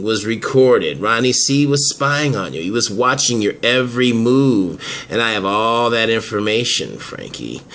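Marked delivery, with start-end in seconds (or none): none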